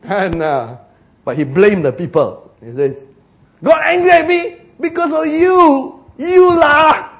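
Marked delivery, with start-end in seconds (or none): 3.6-7.1 loud, high Singlish blaming voice; hammed-up Singaporean Moses pointing fingers; Singaporean accent. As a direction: Singlish accusation with a loud lah. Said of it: "If he was Singaporean".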